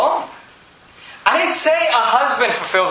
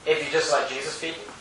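dropped soft; 7 semitones lower, 10 dB quieter than usual. Real, not acted. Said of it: "quiet question to congregation".

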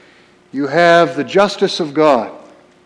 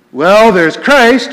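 louder, higher, drawn-out announcement; full voice; 4 semitones higher, 5 dB louder, slower than usual. Acted. Preacher naming Christ in Rome's doctrine, full voice. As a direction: say it out loud, slowly and firmly. First take before the hushed repeat.

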